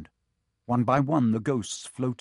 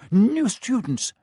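much higher, louder, slow and beaming; jolly booming friar; 11 semitones higher, 8 dB louder, slower than usual. A narrator's reading. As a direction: greet them with a big smile. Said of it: "said the fat friar, smiling".

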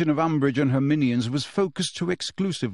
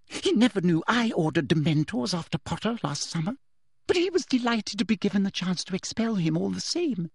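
higher, confiding woman's voice; boastful official; 6 semitones higher than usual. A narrator's reading. Teacher reveals her secret deed, boastful. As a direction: confess it with pride, pitched up.

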